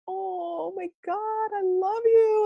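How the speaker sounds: put-on high, slow cooing to a baby; sweet baby talk. Adult gushing over a baby, high and slow. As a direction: coo it like you're holding a newborn. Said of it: "very tenderly and very sweetly".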